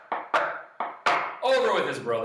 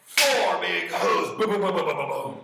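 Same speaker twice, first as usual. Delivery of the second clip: loud, higher, stretched then rapid booms; punchy action narration; 7 semitones higher, 8 dB louder than usual. A narrator's reading. Storyteller acting out the goat's kicks, loud and punchy. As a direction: hit it hard, then rattle off the thuds.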